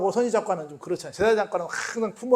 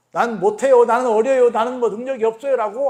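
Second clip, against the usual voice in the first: loud, higher mock whine of excuses; a louder, higher childish protest; whining excuse; 4 semitones higher, 7 dB louder than usual. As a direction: play the reluctant child making excuses. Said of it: "평가절하하는 아이라고 말하지 마".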